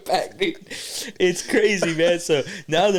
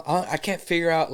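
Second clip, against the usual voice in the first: ordinary talk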